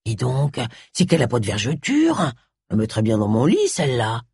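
put-on low, softened old man's voice; old village man. A narrator's reading. Old villager ogling the tied-up woman, low old man's voice. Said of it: "Deux vieux messieurs du village".

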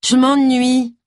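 high-pitched pouting whine; petulant, whiny complaint; sulky whine. A narrator's reading. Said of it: "geignit Roy Boudeur".

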